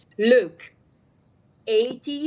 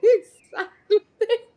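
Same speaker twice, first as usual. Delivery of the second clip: laughing; 9 semitones higher than usual. Real, not acted. Laughing reply.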